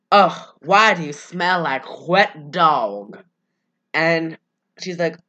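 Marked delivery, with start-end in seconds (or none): 0.1-3.2 slow, lower disgusted groan; mock disgust. Alice sniffing at Bella, slow mock disgust. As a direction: drag it out, repelled by the smell. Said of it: "Alice is like".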